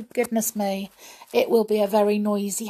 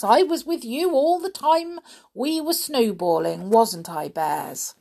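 put-on high, lively girl's voice; little Goldilocks protesting; 5 semitones higher, 5 dB louder than usual. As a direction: pipe up in your own defence. Plays Goldilocks defending herself.

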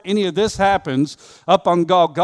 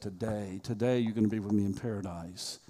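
tender promise; 8 semitones lower, 11 dB quieter than usual. Acted. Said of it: "And Jesus said".

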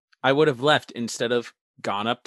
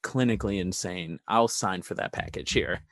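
sheepish apology; 5 semitones lower, 5 dB quieter than usual. Acted. Parent excusing son to delivery man, low, soft and apologetic. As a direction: sigh it out like an embarrassed parent.

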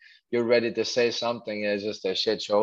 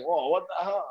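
mock shock; 7 semitones higher than usual. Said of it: "and I'm like".

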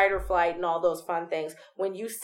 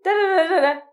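high, loud teasing voice calling after them; squeaky come back plea; mock students walking off; 11 semitones higher, 5 dB louder than usual.

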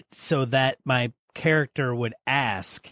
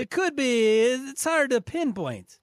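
put-on very high, warbled made-up Transylvanian accent; warbling Dracula-French accent; 10 semitones higher than usual. Professor character dodges the question, warbled Transylvanian accent. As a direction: swoop through an unplaceable Dracula-French accent. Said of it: "It just warbled".

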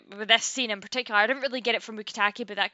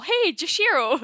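higher, louder imitated call; mock shout; 7 semitones higher, 5 dB louder than usual. Imitating a punk shouting over all these other people, mock yell. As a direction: shout over everyone, playfully. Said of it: "just gonna shout over them all".

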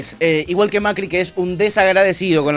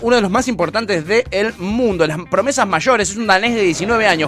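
lightly hammed-up reporter read, higher pitch; mock sports reporter; 6 semitones higher than usual. Host presenting tennis scandal clip, mock reporter voice. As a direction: announce it like a gossip TV host.

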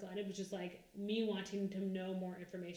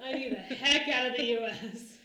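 laughing mock plea; 7 dB louder than usual. Mock pleading of the pigeon.

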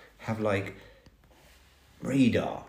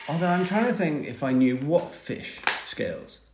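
normal talking voice

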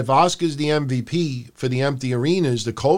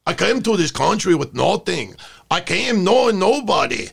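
hammed-up Scottish accent drifting into Mexican; botched Scottish accent; 2 semitones higher than usual. Podcast host imitating a wrestler's promo, bad Scottish accent. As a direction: attempt a Scottish accent and let it slide toward Mexican. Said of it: "drifting from Scottish accent into Mexican".

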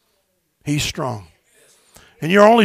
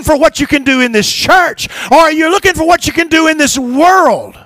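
raised, higher preaching call; preaching shout; 8 semitones higher, 3 dB louder than usual. Real, not acted. Pastor challenging the congregation, preaching loud. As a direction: press the question home to the church.

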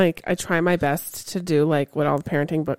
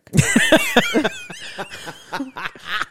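long burst of laughter; real, helpless laugh; 6 semitones higher than usual. Real, not acted. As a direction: crack up at your own joke. Laughing at own dramatic joke.

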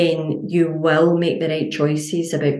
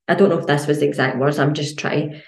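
usual speaking voice

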